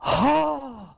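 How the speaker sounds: big gasp into a high "Oh!"; gasp, then let out a loud, high oh; relieved gasp. Acted. Relief after fooling the guards.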